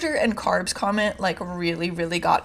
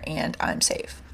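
whispered; 5 semitones lower, 7 dB quieter, slower than usual. Retold or imitated. Retelling her own answer.

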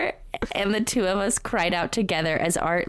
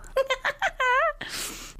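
high giggle. Real, not acted. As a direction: let out a breathy giggle. Laughs after reading.

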